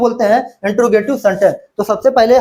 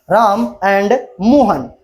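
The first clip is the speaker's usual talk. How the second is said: slow dictation; much slower than usual. Shown as a demonstration. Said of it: "slow dictation while writing".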